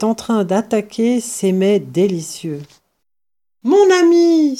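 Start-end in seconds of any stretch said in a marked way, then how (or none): 3.6-4.6 high, loud joyful cry; joyful exclamation. Acted. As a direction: greet him with a delighted cry. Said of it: "s'écria Dame Renard".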